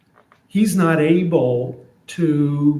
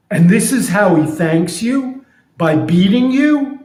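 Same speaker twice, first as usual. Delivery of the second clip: higher and louder, disbelieving friend; incredulous friend; 5 semitones higher, 4 dB louder than usual. Retold or imitated. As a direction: friend shocked at the ingratitude. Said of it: "So here's what I'm imagining".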